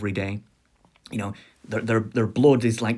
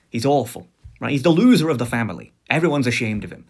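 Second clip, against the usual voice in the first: ordinary talk, nothing marked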